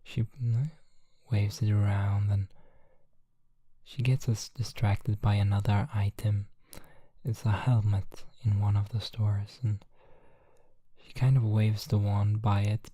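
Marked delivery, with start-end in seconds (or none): none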